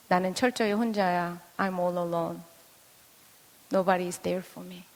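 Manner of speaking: low, very soft, slow confession; lonely inner voice. Acted. Child who feels nobody is there, quietly forlorn. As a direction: voice a child's hidden loneliness, hushed. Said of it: "걔의 마음속에도".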